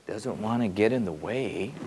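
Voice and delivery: normal talking voice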